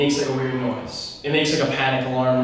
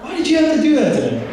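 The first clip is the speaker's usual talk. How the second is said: much higher, fast, hurt whine; whiny protest; 13 semitones higher, 4 dB louder, faster than usual. Retold or imitated. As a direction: whine it like a wronged kid. Whining at dad over money.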